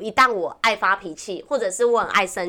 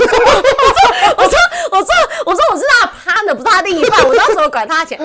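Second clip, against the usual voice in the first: half laughing, half protesting, way up high; laughing high retort; 12 semitones higher, 10 dB louder than usual. Retold or imitated. Retelling her reply to the fortune teller, laughing loud and high. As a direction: squeal the words out, high and loud, through laughter.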